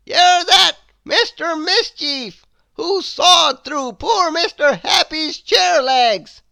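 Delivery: loom over the culprit as a stern wizard. A narrator's reading. Wizard scolding Mister Mischief, stern and loud. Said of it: "said the wizard".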